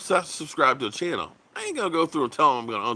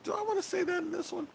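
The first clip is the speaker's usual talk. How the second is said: musing to himself; 8 dB quieter, faster than usual. Retold or imitated. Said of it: "And I said".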